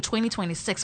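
ordinary talk

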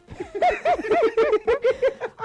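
long high burst of laughter; helpless high laugh; high laughing fit. Real, not acted. Laughing at own joke.

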